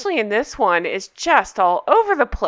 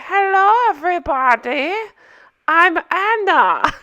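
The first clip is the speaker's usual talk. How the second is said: mock Anna accent; 7 semitones higher, 3 dB louder than usual. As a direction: put on Anna's odd Russian-German accent. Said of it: "I'm so distracted by the accent".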